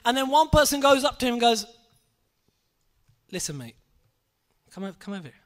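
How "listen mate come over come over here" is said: hushed aside. Retold or imitated. Man quietly calls Guru Nanak aside.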